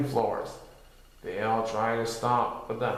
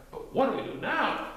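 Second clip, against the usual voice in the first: high questioning voice; 12 semitones higher than usual. A narrator's reading. High character voice.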